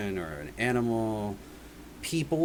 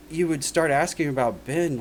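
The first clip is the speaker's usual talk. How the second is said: ordinary talk, nothing marked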